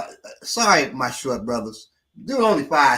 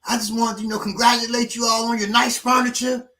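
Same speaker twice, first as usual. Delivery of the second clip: 1 mock friendly stranger; 8 semitones higher, 3 dB louder than usual. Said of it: "He said".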